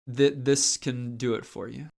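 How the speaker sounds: ordinary talk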